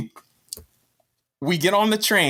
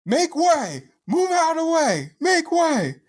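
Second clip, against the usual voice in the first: higher imitated shouting; mock yell; 11 semitones higher than usual. Retelling the rude rider on the packed train, yelled. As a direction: bark it like a pushy guy on the train. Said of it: "Some dude just screaming at people".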